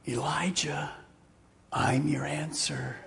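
whispered; still small voice. Acted. God speaking softly to Elijah in a whisper. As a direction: breathe it like a secret. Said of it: "a still small voice".

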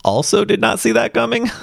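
said high and laughing through it; amused. Real, not acted. To listeners about the twist, high and laughing. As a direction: let the laugh lift the whole line up high.

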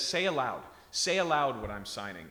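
mock insistent urging; insistent plea. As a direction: urge him to speak it aloud. Woman pressing neighbor in film.

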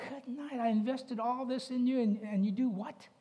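high-pitched mock exasperation; a parent throwing up their hands at the kids; exasperated parent. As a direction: play the fed-up parent. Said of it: "and you go".

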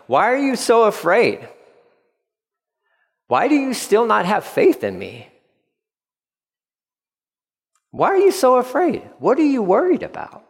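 high, questioning voice; Jesus asking gently. Retold or imitated. Jesus asks his frightened disciples, high and questioning. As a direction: ask them why they fear, pitch lifted. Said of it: "And he asked them".

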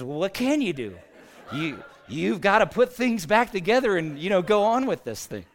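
exasperated Southern funeral director, lightly hammed up; Jerry. Funeral director explaining what he did next, loud Southern accent. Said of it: "imagine a thick Southern accent".

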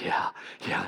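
soft unvoiced little yes; sheepish whisper. Granddaughter admitting she wants cake, whispered. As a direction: whisper it like a caught child. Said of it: "She goes".

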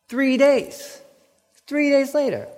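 high exasperated repeat of the phrase; stunned it was only that short; incredulous. Real, not acted. Preacher stressing the short time.

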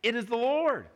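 high-pitched, half-hearted cry of recognition; mock shout of discovery; retold shout. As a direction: call it out high, without full volume. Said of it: "so he shouts out".

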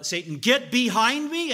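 loud, slow command; commanding rebuke. Retold or imitated. Jesus rebuking Satan, loud and slow. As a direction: order Satan away. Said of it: "and said".

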